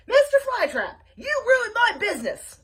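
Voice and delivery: high, loud angry shout. Acted. Coco Dodo shouts from his motorcycle, high and loud. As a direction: yell the blame at him. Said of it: "Coco Dodo was shouting".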